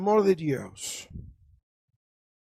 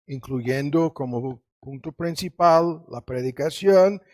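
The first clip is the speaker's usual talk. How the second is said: ordinary talk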